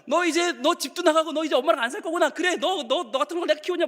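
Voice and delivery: high-pitched mock maternal outrage; indignant mother. Mother to the child walking out, indignant. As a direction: rail at the departing child. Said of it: "나가면".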